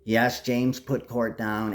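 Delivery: normal talking voice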